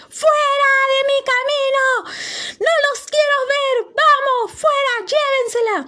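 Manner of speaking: la reina grita furiosa, muy aguda y fuerte. Acted. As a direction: gritos de rabia a los guardias. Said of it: "se puso tan, pero tan furiosa que dijo". (la reina ordena a los guardias llevarse a Sofía, gritando furiosa)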